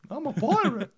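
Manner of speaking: high, loud cartoon pirate; squeaky old-timey cartoon pirate; vintage cartoon voice. Joins the cartoon voice bit.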